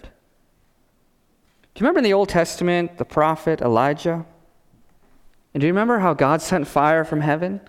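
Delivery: normal talking voice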